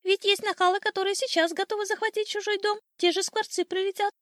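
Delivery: high, insistent bird chatter; shouting old sparrow. A narrator's reading. Sparrow warning his wife of starlings, high urgent chirping. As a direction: press the point in a high, agitated chirp. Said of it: "крикнул старый воробей".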